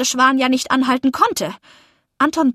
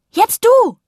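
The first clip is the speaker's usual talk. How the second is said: high, loud challenge; bright-pitched triumphant shout; calling out; 10 semitones higher, 6 dB louder than usual. A narrator's reading. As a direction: call it out to someone up the hill. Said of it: "rief ich".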